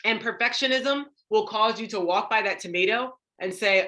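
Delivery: ordinary delivery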